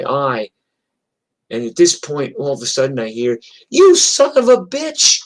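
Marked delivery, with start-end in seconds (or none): 3.7-5.2 high, loud, drawn-out battle cry; furious yell. Retold or imitated. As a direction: scream it as you charge in to attack. Retold attacker's yell in fight.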